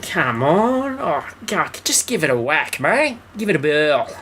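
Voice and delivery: high, over-the-top Aussie voice; mock Australian accent. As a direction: play a cartoon Australian bloke. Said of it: "He's very Aussie at the beginning".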